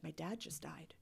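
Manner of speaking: soft breathy whisper of a grieving friend; whispered grief. Retold or imitated. Retelling a grieving classmate at the hospital, whispered. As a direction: barely whisper it, retelling her words. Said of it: "you could tell she's crying".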